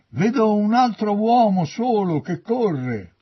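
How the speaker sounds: loud, high lookout's shout; calling out. A narrator's reading. Sentinel calls to the gatekeeper below, shouting. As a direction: cry the sighting down from the walls. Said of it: "gridò al portiere".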